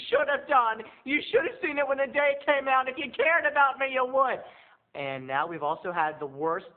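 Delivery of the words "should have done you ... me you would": mock guilt trip.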